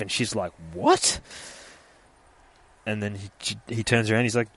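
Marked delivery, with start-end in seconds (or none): 0.7-1.2 high, loud startled "what"; shocked. Retold or imitated. Woman reacting to what she's told, shocked. Shocked reply to overheard line.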